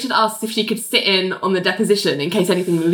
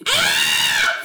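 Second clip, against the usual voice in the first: very high mock yelp; imitated scream of pain. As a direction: let out a sudden high shriek, play-acted. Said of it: "we just hear this".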